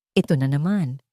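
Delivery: usual speaking voice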